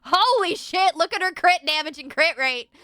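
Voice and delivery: very loud, high shriek of amazement; excited shout. Real, not acted. Player seeing her crit damage and crit rate, shrieking with excitement. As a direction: shout it high, thrilled and stunned.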